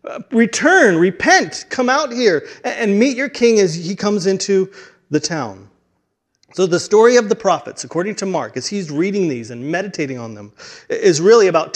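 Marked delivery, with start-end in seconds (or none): 0.0-5.7 high, urgent summoning call. Acted. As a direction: call the people out to meet their king. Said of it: "almost like calling out".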